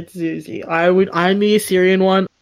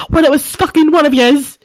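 loud, higher hammed-up disgust; mock outraged shout; 7 semitones higher, 7 dB louder than usual. Reenacting a toilet row from a video, mock outraged shouting. Acting angry quarrel from video.

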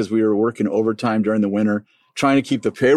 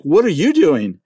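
bewildered; 8 semitones higher than usual. Retold or imitated. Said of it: "I'm like".

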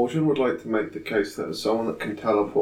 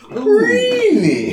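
shrill high-pitched "really"; squeaky disbelief; 7 dB louder than usual. Acted. Incredulous reaction to nice staffs.

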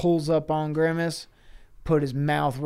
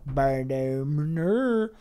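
very slow drawn-out creature words; slow cartoon creature voice; Grimace creature sound; much slower than usual. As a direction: drag out a goofy creature mumble. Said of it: "Grimace go".

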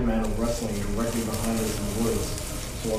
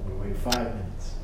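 ordinary delivery

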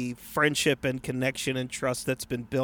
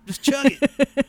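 high, slow party bro; 9 semitones higher, 6 dB louder, slower than usual. Pretend. Voicing party pusher.

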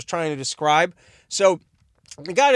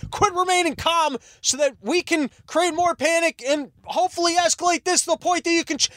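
much higher, squeaky mock cop voice; mock cop falsetto; 12 semitones higher than usual. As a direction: parody the cops in a silly high falsetto. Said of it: "they're like".

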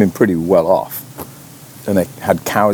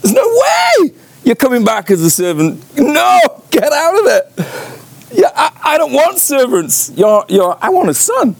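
tearful overjoyed father. Retold or imitated. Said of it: "and the father says".

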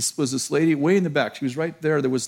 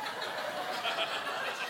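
silent laugh; 7 semitones higher, 16 dB quieter than usual. Retold or imitated. God laughing at him.

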